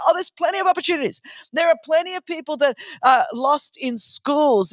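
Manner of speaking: cheerful dismissive reply; breezy retort. Retold or imitated. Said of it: "I go".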